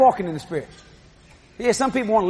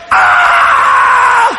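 high shrieking scream; child's scream; 10 dB louder than usual. Retold or imitated. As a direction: let out a startled child's scream. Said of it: "And Jody".

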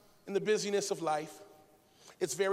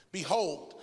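usual speaking voice

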